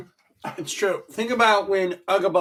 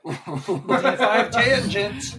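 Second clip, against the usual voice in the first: drawn-out announcer; 5 semitones higher, much slower than usual. Pretend. Drawn out joke title.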